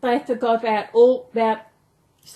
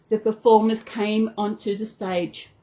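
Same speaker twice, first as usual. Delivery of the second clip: ordinary delivery, nothing marked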